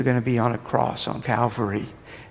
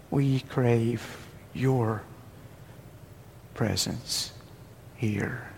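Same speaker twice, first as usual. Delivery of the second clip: slow, soft, halting prayer; earnest prayer; 10 dB quieter, much slower than usual. Retold or imitated. The committee praying at the altar, slow soft longing. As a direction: linger on each word, longing.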